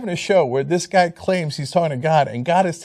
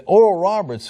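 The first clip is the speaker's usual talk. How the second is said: usual speaking voice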